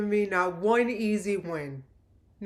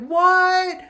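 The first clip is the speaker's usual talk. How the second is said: loud, high shocked yell; retold scream; 8 semitones higher, 8 dB louder than usual. Fan hearing her team won, screaming in shock. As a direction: shriek "What?" in disbelief. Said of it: "I screamed".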